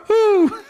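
mock gunshot. Gunshot after door shuts in retold scene.

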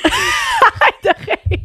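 high, slow, laughing plea. Real, not acted. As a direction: beg through giggles. Can't stop laughing telling story.

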